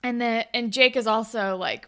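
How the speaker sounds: ordinary talk, nothing marked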